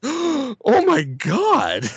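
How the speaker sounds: high, very slow, stunned "oh my God"; a theatre audience reacting to the dog being shot, stunned and drawn out; shocked audience. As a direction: stretch it out like a horrified crowd. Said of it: "And everyone's like".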